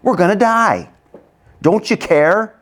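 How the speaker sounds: high-pitched alarmed cry; panicked rebuke. Acted. Apostles rebuking Jesus, panicked rebuke. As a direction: accuse him, alarmed. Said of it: "They're rebuking Jesus".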